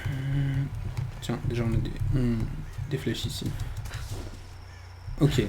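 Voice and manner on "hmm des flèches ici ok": ordinary delivery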